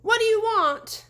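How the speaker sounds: high, loud panicked question; frightened cry. A narrator's reading. Terrified deputy begging the ghost, high loud cry. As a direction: cry out in fear. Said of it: "the deputy cried".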